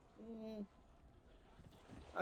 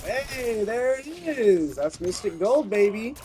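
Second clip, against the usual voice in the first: excited; 8 semitones higher, 8 dB louder, slower than usual. Real, not acted. Gold camo finally unlocks.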